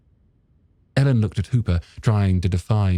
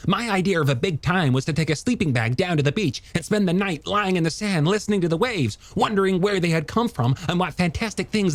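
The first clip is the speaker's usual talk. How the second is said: high, quick, animated character voice; enthusiastic young scientist; 11 semitones higher than usual. Acted. Scientist telling her how he got hooked, high eager voice. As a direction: tell it eagerly, pitched well up.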